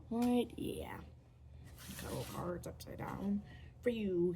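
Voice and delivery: normal talking voice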